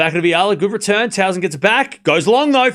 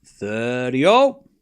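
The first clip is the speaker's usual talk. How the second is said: slow low drawl; 6 semitones lower, much slower than usual. Shown as a demonstration. Streamer repeating score in slow drawl. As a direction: draw out thirty all slowly, low.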